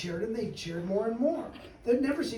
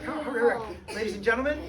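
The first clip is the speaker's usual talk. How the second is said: usual speaking voice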